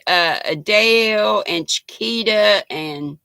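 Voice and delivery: usual speaking voice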